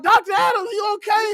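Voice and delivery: nurses calling loudly. Retold or imitated. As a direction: call through the bathroom door. Nurses calling through the door.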